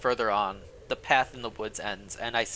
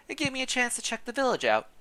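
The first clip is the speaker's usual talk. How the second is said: put-on a friendly old servant, pitched up; old housekeeper; 12 semitones higher than usual. Housekeeper Gilberte greeting the newcomer, high old woman voice.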